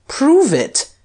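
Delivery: high, loud challenge; scornful. A narrator's reading. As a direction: throw down a scornful dare. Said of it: "said the Duke scornfully".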